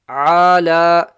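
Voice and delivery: ALA with ayn from mid-throat, loud and long; clear ayn. Shown as a demonstration. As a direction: squeeze ALA from the middle of the throat, vowels held. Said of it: "read at the end the ayn clearly".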